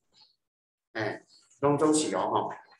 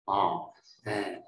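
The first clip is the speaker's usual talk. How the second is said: usual speaking voice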